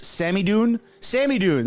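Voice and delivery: landing the name Samidoun at last; finally right name. Real, not acted. A host to his viewers, getting Samidoun right. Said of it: "there you go".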